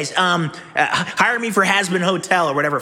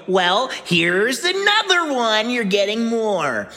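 usual speaking voice

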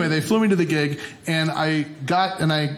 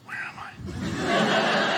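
confused whisper; 4 semitones higher, 4 dB quieter, much slower than usual. Retold or imitated. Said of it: "I was like".

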